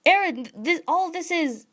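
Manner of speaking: a hint of a startled boy; flustered Armin. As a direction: Armin groping for words, confused. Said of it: "so he's like".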